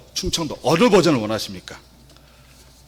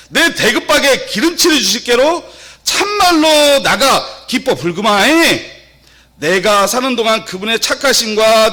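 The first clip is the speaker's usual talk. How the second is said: Jeolla dialect; 6 semitones higher, 5 dB louder than usual. Shown as a demonstration. Reciting Psalm 23 for the congregation, hammed-up Jeolla dialect. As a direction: belt out broad Jeolla dialect, stretching the exclamations. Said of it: "전라도 버전 한 번만 해드리겠습니다".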